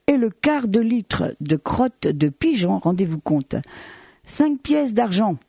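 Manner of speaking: ordinary talk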